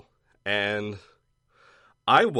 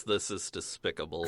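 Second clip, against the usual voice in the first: put-on Transylvanian accent; 6 semitones lower, 9 dB quieter than usual. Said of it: "he says".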